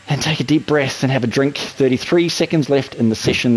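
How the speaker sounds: usual speaking voice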